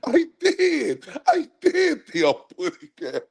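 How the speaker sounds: high cartoon bird voice breaking into laughs; high lisping bird voice, giggling through it; laughing Tweety voice. Third Tweety take, laughing.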